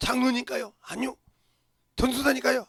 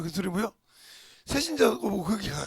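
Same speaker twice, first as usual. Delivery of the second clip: normal talking voice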